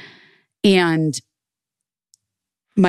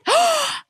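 gasp. Retold or imitated. Surprise gift reveal.

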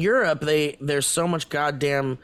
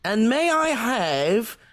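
put-on higher, slowed Australian accent; mock Australian; 6 semitones higher than usual. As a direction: order a slushy as a cartoon Aussie. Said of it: "our impression of an Australian".

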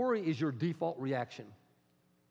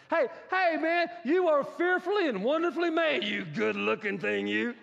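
hyped pep talk; 11 semitones higher, 7 dB louder than usual. Pretend. Talking to yourself in mirror.

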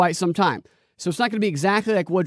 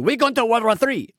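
Hitler impersonation; 7 semitones higher than usual. Retold or imitated. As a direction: ham it up as an aggressive Hitler. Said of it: "That was my Hitler impersonation".

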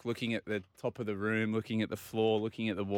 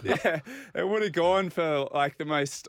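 laughing through the words, a bit slower; 6 semitones higher than usual. Real, not acted. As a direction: chuckle while recalling the embarrassment. Laughing at his own awkward memory.

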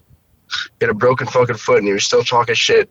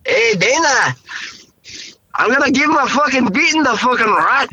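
high, hammed-up Irish accent; rough Irish fighter, pitched up; 12 semitones higher than usual. Shown as a demonstration. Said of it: "Do an Irish real quick".

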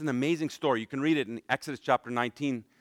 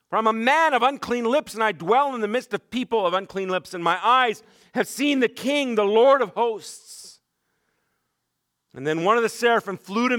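preaching at full force; 7 semitones higher, 5 dB louder than usual. Acted. Preacher to congregation, reading Isaiah at full force. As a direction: proclaim the vision with rising fervour.

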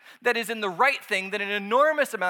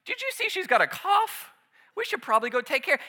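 higher, breezy, unhurried aside; mock casual distraction; 6 semitones higher, 4 dB louder than usual. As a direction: act like nothing is urgent. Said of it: "and he's like".

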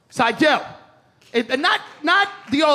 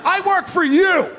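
hammed-up furious yelling; aggressive shout; 6 semitones higher than usual. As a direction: bark it like a pushy salesman. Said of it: "I'm like".

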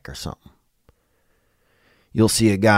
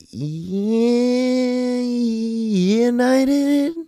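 much higher put-on voice, drawn out and sarcastic; mock British voice; much slower than usual. Comic mocking a divided United Kingdom, high put-on voice. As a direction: squeal it like a snooty Brit.

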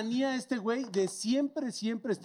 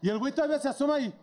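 ordinary talk, nothing marked

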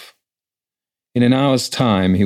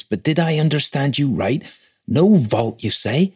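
higher, incredulous clerk voice; shocked; 7 semitones higher than usual. A narrator's reading. Clerk querying the rector's burial orders, shocked. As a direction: question it in rising disbelief. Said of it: "said Wickham in a shocked voice".

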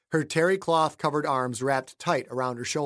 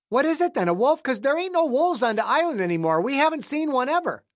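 higher, louder wife's voice, alarmed; 10 semitones higher, 4 dB louder than usual. Acted. Wife questioning James about a wolf, alarmed. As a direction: push it up high in alarm.

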